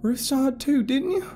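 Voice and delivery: Carol's voice. A narrator's reading. Carol urging the group to back her up, insistent. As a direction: press the group to believe her.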